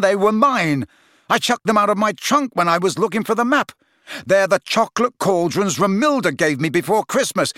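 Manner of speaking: high, heated explanation; exasperated. Acted. Friend explaining love potion, exasperated. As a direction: hammer the truth home, exasperated.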